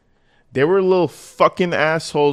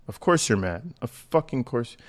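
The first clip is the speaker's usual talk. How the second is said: ordinary delivery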